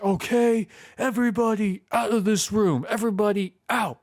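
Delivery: imitating a guy taking charge of a room; mock commanding. Clark ordering everybody out of the room, mock commanding. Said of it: "telling everybody to get out".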